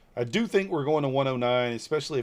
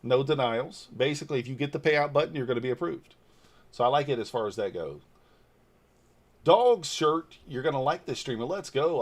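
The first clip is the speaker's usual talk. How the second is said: usual speaking voice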